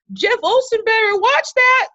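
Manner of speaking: high, loud teasing friend voice; playful girlfriend impression, pitched high; sassy friend. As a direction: tease it in her voice, way up top. Friend's excited quote retold.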